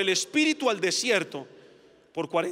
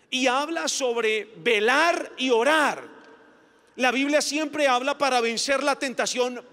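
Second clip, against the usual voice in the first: ordinary delivery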